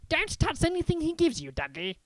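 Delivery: sharply. Acted. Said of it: "Uncle Vernon said sharply".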